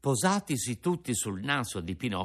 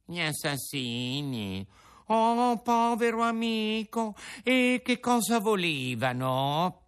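fox's mock shock; 9 semitones higher than usual. A narrator's reading. Fox feigns shock.

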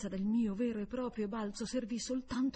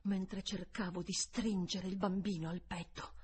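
ordinary delivery

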